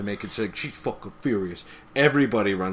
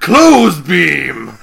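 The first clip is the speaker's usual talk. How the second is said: loud, high superhero shout; hammed-up shout on the edge of laughter; mock attack cry; 5 semitones higher, 15 dB louder than usual. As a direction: yell it like a cartoon power move. Shouts invented attack name.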